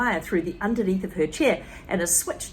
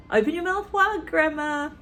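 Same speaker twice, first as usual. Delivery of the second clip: put-on higher, coaxing boy voice; lightly voiced George; sweet-talking boy; 8 semitones higher than usual. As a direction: coax her like a sly little boy. George gives medicine.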